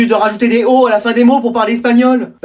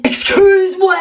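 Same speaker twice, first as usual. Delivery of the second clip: shocked outburst; 10 semitones higher, slower than usual. Acted. Shocked by the bad grades.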